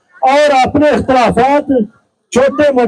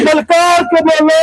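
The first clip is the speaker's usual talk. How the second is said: booming political rally speech; 3 semitones higher than usual. Real, not acted. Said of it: "मेरे नारों का जवाब देंगे".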